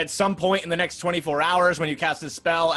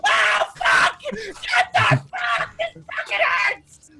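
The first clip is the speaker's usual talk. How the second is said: high, loud screams of pain; burning scream; 3 dB louder, much slower than usual. Acted. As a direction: shriek like you're burning alive. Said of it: "sizzling in lava and he's like".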